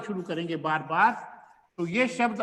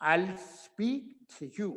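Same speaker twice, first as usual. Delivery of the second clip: I'll speak to you, slow, to you run together; teacher gives the right version; correct linked form; 6 dB quieter, much slower than usual. Shown as a demonstration. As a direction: join to you smoothly and slowly. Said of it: "क्या बोलेंगे?".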